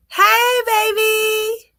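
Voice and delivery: joyful greeting. Real, not acted. Reader greeting the newborn on screen, high and loud.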